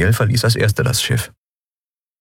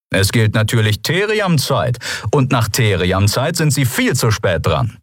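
leicht verstellte, gönnerhafte Stimme; arroganter Geschäftsmann; 4 semitones higher than usual. Acted. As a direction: belehrt sie von oben herab. Delarco erklärt Ortszeit. (Delarco zu Xea und der Kapitänin, gönnerhaft)

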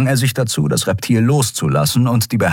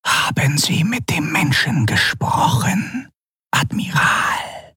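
slow, hushed, distant whisper; cold and menacing, barely voiced; sinister whispering governor; 8 semitones higher, slower than usual. Governor whispering coldly to the admiral for his report.

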